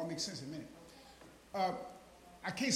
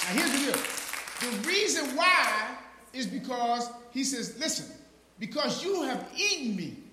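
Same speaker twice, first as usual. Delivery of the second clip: high, loud shouted sermon; preaching shout; 6 semitones higher, 9 dB louder, slower than usual. Acted. Preacher defying the devil before his congregation, shouting. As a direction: thunder it at the devil.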